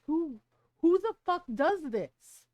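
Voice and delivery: loud, stretched disbelief; mock yell of outrage at the boyfriend; outraged shout. Retelling her outrage at daughter's partner, loud and appalled.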